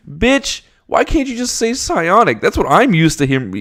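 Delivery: very high, loud indignant whine; exasperated complaint. Real, not acted. Complaining to the writer about the term, a high loud exasperated whine. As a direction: demand an answer from the author.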